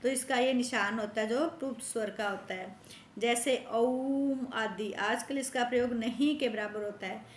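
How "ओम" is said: ओम with the O held long; prolonged vowel. Shown as a demonstration. Teacher demonstrating the pluta vowel to pupils, long drawn-out ओम. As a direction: stretch the vowel of ओम out slowly.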